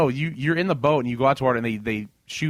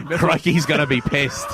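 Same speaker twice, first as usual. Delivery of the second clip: slow put-on Aussie voice; hammed-up Aussie aside; Australian accent; 2 semitones higher, 4 dB louder, slower than usual. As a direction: stretch it out like a comic Australian. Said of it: "Oh, Australian".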